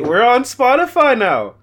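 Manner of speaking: high, excited and loud; excited announcement. Real, not acted. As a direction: announce it like big news. Announcing the new platform.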